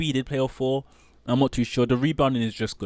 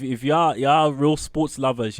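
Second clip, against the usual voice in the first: ordinary talk, nothing marked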